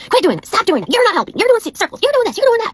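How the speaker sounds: high, loud impression of a mom screaming; mock screech, scolding in rapid bursts; imitated screaming. Lady screaming at her kids in Walmart, mock scream. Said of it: "literally screaming at her kids".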